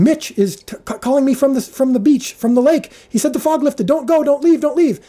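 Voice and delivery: a retold assistant director yelling not to leave; frantic shout. Assistant director calling across base camp, frantic high shout. AD yelling news the fog lifted.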